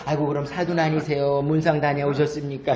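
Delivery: low standard Korean dialogue; standard Korean version. Shown as a demonstration. Speaker translating the grandmothers' replies, in standard Korean. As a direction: voice the exchange plainly in standard Korean. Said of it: "표준 말로 하면".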